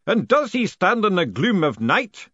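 grand booming challenge; demanding, booming. A narrator's reading. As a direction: boom out the ritual question. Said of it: "the voice demanded".

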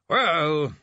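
drawn-out, mildly weary. Acted. Bursar turns from the sleeping chairman, wearily. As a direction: sigh it out with some pitch. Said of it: "he said wearily".

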